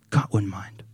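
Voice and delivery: hushed devilish whisper. Acted. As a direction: whisper it like a secret.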